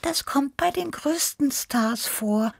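slow, very high, gentle cat voice; sympathetic Tippi. A narrator's reading. Small cat excuses the nervous star, sympathetic. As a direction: console in a tiny high voice. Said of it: "Tippi nickte mitfühlend".

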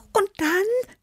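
very high, eager prompt; impatient squeaky question; urging Tibi. A narrator's reading. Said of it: "drängte Tibi".

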